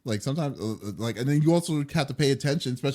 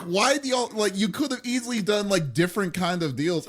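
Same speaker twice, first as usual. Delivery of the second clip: exasperated; 8 semitones higher than usual. Retold or imitated. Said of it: "And I'm just like".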